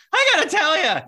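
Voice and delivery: loud, very high cracking voice; Tim Kurkjian. Retold or imitated. Admirer meeting a young host, high excited impression. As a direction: gush in a squeaky impression, voice cracking with enthusiasm. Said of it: "hearing his voice crack".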